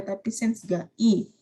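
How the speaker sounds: "sense ga i" slowed, every syllable clear; careful model of "sense ga i"; teacher's slow right reading. Shown as a demonstration.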